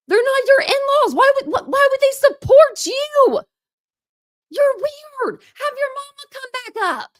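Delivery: shrieking, losing your temper at him; getting heated. Real, not acted. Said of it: "I'm getting heated".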